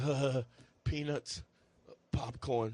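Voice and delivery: low, soft mumbling of someone coming to; groggy, half-awake. Retold or imitated. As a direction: imitate a dazed man waking up. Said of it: "starts waking up, and he goes".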